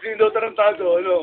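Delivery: loud annoyed question; yelling. Real, not acted. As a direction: shout it across the room. Siblings bickering, yelling.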